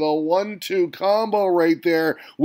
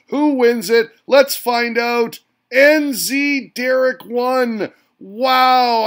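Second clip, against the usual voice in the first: higher, louder, slowed-down reveal; suspense reveal; 4 semitones higher, 5 dB louder, slower than usual. Real, not acted. Host revealing the close round's winner, slow then loud. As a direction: drag out the result then burst out.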